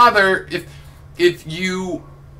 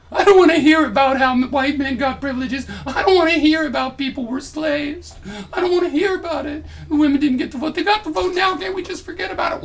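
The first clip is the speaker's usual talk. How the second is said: high, whiny mocking impression of a complainer; 12 semitones higher, 4 dB louder than usual. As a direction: squeal it out like a sulking man-child, ending in a yell. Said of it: "Why are you such an emotional, whiny bitch?".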